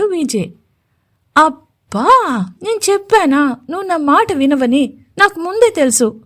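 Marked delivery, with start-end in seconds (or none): 1.4-6.2 irked, higher-pitched scolding; petulant annoyance. Acted. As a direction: sulk at him, pitch rising. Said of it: "అని ఉక్రోషంగా అంది".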